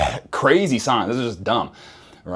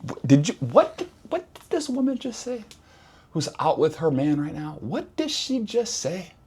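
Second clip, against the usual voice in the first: high, incredulous inner voice; stunned disbelief; 6 semitones higher, 4 dB quieter than usual. Retold or imitated. His own shocked thoughts at the cafe table, incredulous. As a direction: squeak out the disbelief. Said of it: "I'm thinking to myself".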